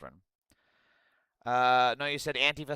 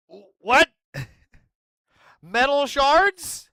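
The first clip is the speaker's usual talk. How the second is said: stunned disbelief; 10 semitones higher, 7 dB louder, slower than usual. Real, not acted. Shocked by metal shards.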